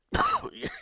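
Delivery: a sudden loud hacking cough. Real, not acted. Host explains his pauses to listeners, loud hacking cough. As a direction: hack hard, as if choking on phlegm. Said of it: "this phlegm in my throat".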